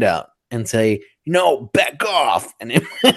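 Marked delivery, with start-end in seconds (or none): 1.2-2.5 higher, drawn-out warning; firm mock command. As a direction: ward off a dinosaur with a slow, raised command. Acts out warding off a dinosaur.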